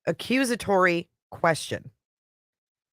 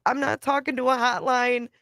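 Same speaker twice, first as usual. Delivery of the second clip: higher, mock-indignant wife voice; imitating the wife; 7 semitones higher than usual. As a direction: play her brushing him off, pitched up. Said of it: "she's like".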